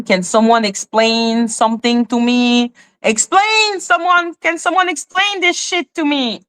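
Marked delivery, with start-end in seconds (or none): 3.0-6.4 high-pitched, baffled begging; exasperated plea. Real, not acted. Confused host begging viewers to explain, high-pitched exasperation. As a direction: plead for help, pitched way up.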